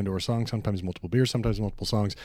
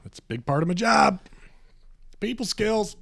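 higher, louder bragging; mock pride; 12 semitones higher, 6 dB louder than usual. Real, not acted. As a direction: boast about it. Joking brag.

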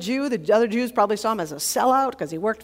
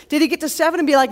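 normal talking voice

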